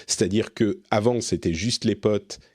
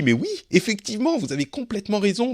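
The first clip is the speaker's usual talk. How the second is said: mock eager agreement; 11 semitones higher, faster than usual. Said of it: "Et moi, je me suis dit".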